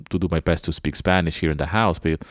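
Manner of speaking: ordinary delivery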